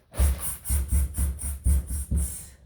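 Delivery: rapid voiceless monkey chatter; sharp repeated tss like cheeky monkeys; mimicking monkeys. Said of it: "stomped their feet back at him and said".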